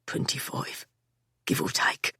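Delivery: quiet, breathy, pained answer; as if it hurts to say. A narrator's reading. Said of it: "a knife was twisting inside his chest".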